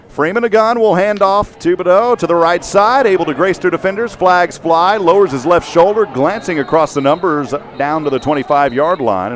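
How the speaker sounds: high, excited running call; live play-by-play. Real, not acted. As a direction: call the run high, easing down at the end.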